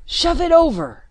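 high, loud, winded command; urgent shout. Acted. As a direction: bark it out in a panic. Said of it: "he panted at Jennifer".

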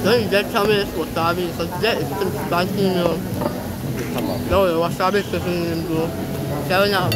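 chewing while talking; speaking around food; mouth full. Real, not acted. Seen 1: Green sushi bite eaten at -5; food visible in mouth while speaking.

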